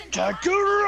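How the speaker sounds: high roaring brute yell; Broly voice. Acted. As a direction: bellow the name like a raging brute. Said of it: "a not that bad of a Broly voice".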